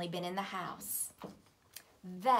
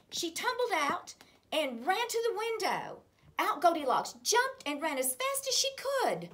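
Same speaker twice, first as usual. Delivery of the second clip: excited chase narration; 9 semitones higher, 7 dB louder than usual. A narrator's reading.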